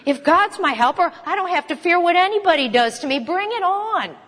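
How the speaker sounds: triumphant, no fear left; confident defiance. Retold or imitated. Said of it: "he was able to say".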